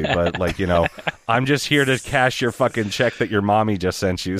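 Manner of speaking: ordinary talk, nothing marked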